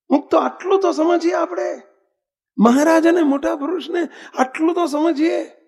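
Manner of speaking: fervent call to the devotees; preaching exhortation. Real, not acted. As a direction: urge the listeners to grasp this.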